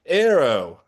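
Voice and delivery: sudden loud shout of one word. A narrator's reading. Actor remembering his line blurts out a loud shout. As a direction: blurt it out loud, eager to help. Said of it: "I shout".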